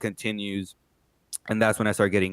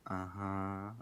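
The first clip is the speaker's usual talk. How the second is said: dazed mumble; 14 dB quieter than usual. Retold or imitated. Dazed response to nurse.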